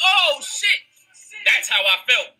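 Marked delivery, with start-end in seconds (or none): 0.1-0.9 high, mock-alarmed oh shit; sudden dawning dread, pitched up; alarmed realization. Man with gun to his head, alarmed.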